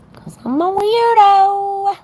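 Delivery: high, loud, drawn-out joke voice; mock-squeaky self-teasing; squeaky silly voice. Real, not acted. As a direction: squeal it like a goofy cartoon. Self-mocking joke about earring.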